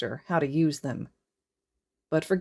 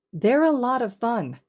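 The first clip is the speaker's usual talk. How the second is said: cheerful; 8 semitones higher, 4 dB louder than usual. Acted. Cheerful classmate encouraging.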